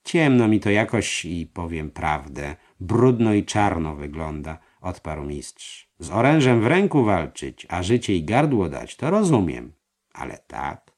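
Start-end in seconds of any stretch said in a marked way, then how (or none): 0.0-4.6 put-on louder, reluctant old master; voiced master. A narrator's reading. 6.0-10.9 louder, higher protesting master; voiced master. A narrator's reading.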